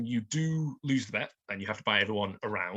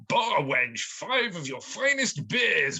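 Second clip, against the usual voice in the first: obnoxious toff; 6 semitones higher, 5 dB louder, much slower than usual. Retold or imitated. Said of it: "plead really obnoxiously".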